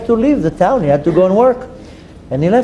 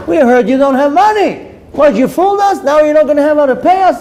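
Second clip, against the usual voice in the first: high, indignant accusing outburst; demanding angrily, pitched well up; angry confrontation; 8 semitones higher, 4 dB louder than usual. Acted. Debt collectors at the door over money, angry and high.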